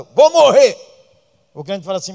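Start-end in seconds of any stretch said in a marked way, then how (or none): 0.0-0.9 loud, high mock cry; loud, high hammed-up cry of despair. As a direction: ham up a dying believer. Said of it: "O crente não fala".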